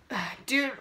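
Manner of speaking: ordinary talk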